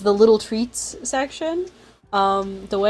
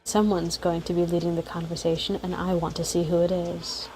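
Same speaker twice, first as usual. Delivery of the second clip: lower, softer storybook reading; story narration; 4 semitones lower, 6 dB quieter than usual. A narrator's reading. Narrate in a low, even storytelling voice. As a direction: streamer reading the game's scene aloud, measured narration. Reads game story aloud.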